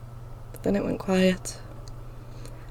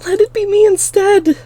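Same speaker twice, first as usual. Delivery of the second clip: high, loud strained begging cry; desperate plea; 13 semitones higher, 10 dB louder than usual. A narrator's reading. Girl pleading to die instead of her sister. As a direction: beg to take her place. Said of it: "I pleaded".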